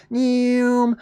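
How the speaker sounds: high, drawn-out rocket noise; rocket whoosh. Retold or imitated. Narrating a rocket going straight up, imitated whoosh.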